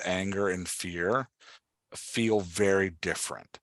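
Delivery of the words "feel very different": ordinary talk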